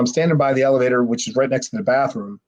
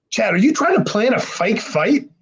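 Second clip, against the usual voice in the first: high, incredulous imitation of the guys; pitched-up accusing question; accusing disbelief; 9 semitones higher than usual. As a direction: question him in mock outrage. Guys accuse Chad of faking.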